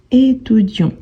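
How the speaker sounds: "étudiant" ending on the nasal vowel, no t; silent final T. Shown as a demonstration. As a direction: drop the t at the end of "étudiant". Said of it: "on ne prononce pas le T à la fin".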